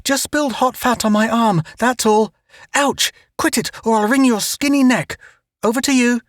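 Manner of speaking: high, strained flustered talk; flustered excuse. A narrator's reading. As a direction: cover up the pain. Pained excuse then scolding native.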